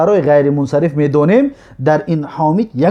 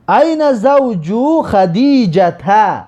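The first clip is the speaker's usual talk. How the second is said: drawn-out Arabic phrase, a little higher; slow model reading; 4 semitones higher, much slower than usual. Shown as a demonstration. Teacher reads the Arabic example aloud to students, very slow. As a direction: stretch each word so students catch it. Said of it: "اینا تکرار کنید".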